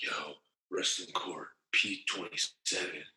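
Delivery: hushed and slow, imitating the narc; mock whisper. A narc quietly summoning him to wrestling court, mock hushed. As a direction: lean in and murmur it like a secret summons. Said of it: "They had somebody come and whisper to you".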